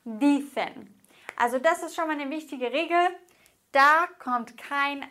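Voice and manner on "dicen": Dicen with weight on DI, cen falling away; penultimate stress. Shown as a demonstration. Teacher repeats the example, Dicen stressed on Di. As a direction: stress the first syllable of Dicen, falling on the second. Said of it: "wird die vorletzte Silbe betont".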